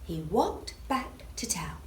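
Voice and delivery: slow, soft, drawn-out slowly; slow and hushed. A narrator's reading. Storyteller slows right down near the end, soft and slow. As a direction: stretch it out, softly.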